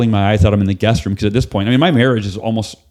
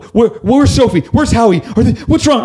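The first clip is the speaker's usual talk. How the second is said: panicked wife; 12 semitones higher than usual. Retold or imitated. Wife woken at dawn fearing for the kids, panicked. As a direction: blurt it out in alarm, half shouting. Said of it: "she just thinks the kids are in a danger".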